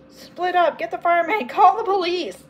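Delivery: high, excited orders; urgent calling out. A narrator's reading. Girl sending others for help, urgently calling out.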